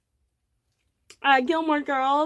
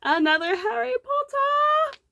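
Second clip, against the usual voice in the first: crappy British accent, pitched way up; fake British accent; 11 semitones higher, 3 dB louder than usual. Real, not acted. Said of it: "that crappy fake British accent".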